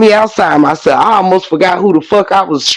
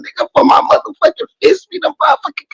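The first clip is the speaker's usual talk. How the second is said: high, fast, rising rant; real worked-up fast rant; 11 semitones higher, much faster than usual. Real, not acted.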